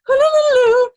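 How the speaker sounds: loud, high madman's whoop; sudden mad holler; wild halloo. Acted. Madman whoops mid rhyme.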